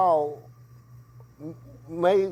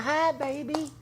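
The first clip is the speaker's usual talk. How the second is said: high, sweet motherly greeting; sweet mama; 11 semitones higher, slower than usual. Retold or imitated. Mother greeting her son on the phone, high sweet coo. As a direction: coo it like a happy mom on the phone. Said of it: "She said".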